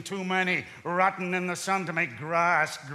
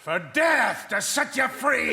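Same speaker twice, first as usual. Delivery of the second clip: bitter shout; 6 semitones higher than usual. Acted. Husband accusing his wife of wanting his death, bitter shout. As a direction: spit out the accusation.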